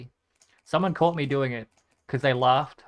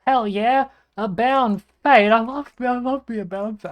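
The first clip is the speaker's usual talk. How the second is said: excited; 8 semitones higher, 10 dB louder than usual. Real, not acted. Delighted loot find.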